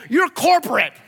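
loud, high mock rebuke; mock dismissal. Mock clerk turning away the waiting line, dismissive. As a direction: exclaim it as a comic put-down.

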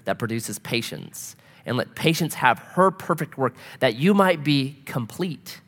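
scripture reading. Real, not acted.

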